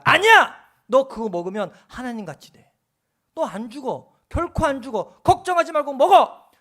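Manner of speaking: loud, high coaxing devil voice, peaking at 아니야 and 먹어; the devil shouting his temptation into Eve's ear; tempting devil. Acted. The devil urging Eve to eat, a loud high tempting cry. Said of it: "마귀가요, 그 불평을 틈타서 쑥 들어옵니다".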